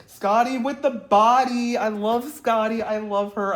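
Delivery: loud, high, drawn-out fan gushing; excited gushing. Real, not acted. Fan thrilled Scotty is back, excited gushing. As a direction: belt out your adoration slowly. Gushing over a favourite's return.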